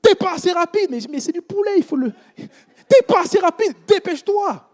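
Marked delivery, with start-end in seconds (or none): 0.0-0.9 higher, mock-shouted rebuke; retold manager yell. Preacher imitates his KFC manager yelling at him. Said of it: "le manager me crie dessus". 2.9-4.7 higher mock yell, pushing him to hurry; retold manager yell. Manager shouting at the young worker to hurry up. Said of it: "le manager me crie dessus".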